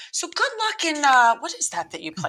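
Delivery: high, far-off mock voice of a clueless parent, pitch jumping up at the start; lightly hammed-up oblivious mom asking what sport it is. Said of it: "my parents would say".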